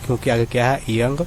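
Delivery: usual speaking voice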